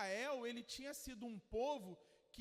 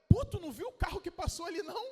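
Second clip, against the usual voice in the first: fast, much louder and higher, excited; excited retelling; 6 semitones higher, 11 dB louder, much faster than usual. Retold or imitated. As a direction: gush about the car she just saw. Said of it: "Aí ela".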